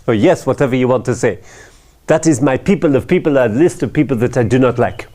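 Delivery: put-on quick, offhand French accent. Finance director shrugging off the owner in a light French accent. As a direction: brush the correction aside in light French accent. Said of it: "it's France, after all".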